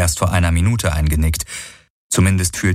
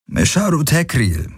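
casual; 9 semitones higher than usual. A narrator's reading. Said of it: "gab er locker zurück".

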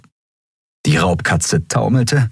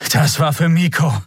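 breathing hard; 8 semitones higher than usual. A narrator's reading. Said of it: "erklärte Kai schwer atmend".

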